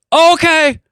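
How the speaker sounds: high-pitched exaggerated holler; overdone calling-out voice; mock distant calling. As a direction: answer as if down the block. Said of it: "act like I'm down the block from them".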